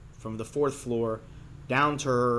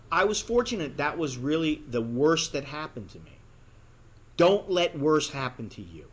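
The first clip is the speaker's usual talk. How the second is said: ordinary delivery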